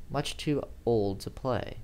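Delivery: usual speaking voice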